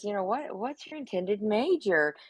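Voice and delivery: normal talking voice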